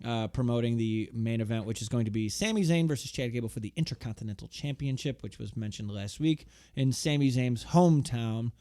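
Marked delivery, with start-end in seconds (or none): none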